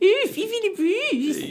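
high, slow mocking coo; mocking sing-song. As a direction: coo the name with a sneer.

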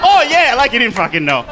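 normal talking voice

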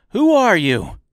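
loud, high demand; cried out. A narrator's reading. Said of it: "cried out".

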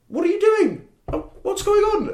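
put-on very high, loud shocked old lady voice; an old lady catching a drunk climbing out of a car, high and shrill-alarmed; startled old lady. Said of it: "and she goes".

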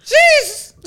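high sharp jeez; shocked outcry. Acted. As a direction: yelp it in disgust.

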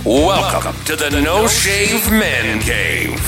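ordinary talk

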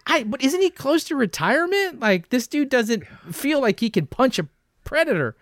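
high-pitched incredulous protest; retold disbelief. Recalling his reaction to Danny Glover's casting, high mock disbelief. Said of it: "And I was like".

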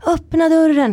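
high-pitched mock yell; retold frantic yell. Grandmother at the door demanding entry, mock frantic. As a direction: imitate someone out of it, calling high. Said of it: "helt borta liksom".

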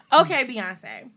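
laughing tease. Real, not acted. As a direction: repeat it laughing. Teasing again laughing.

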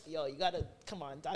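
soft, low-key wheedle; imitated pleading. Girl coaxing security to let her in, soft pleading. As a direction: plead quietly, voice dropping off. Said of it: "sad girl face on".

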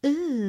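high teasing coo; teasing ooh. Retold or imitated. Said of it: "they're like".

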